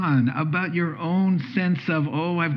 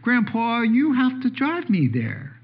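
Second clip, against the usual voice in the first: put-on higher, a light child's plea; 7 semitones higher than usual. Grandson asking Grandpa to drive him, lightly childlike. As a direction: ask Grandpa to drive like a small kid. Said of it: "my four-year-old grandson".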